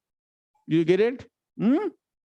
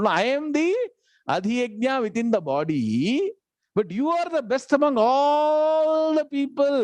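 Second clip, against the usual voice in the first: higher, lifted praise with a long stretched "all"; complimenting; 8 semitones higher than usual. Acted. Bhagavan giving the praise back to Arjuna, complimenting him. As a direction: praise generously, drawing out "all". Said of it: "how he's complimenting him back".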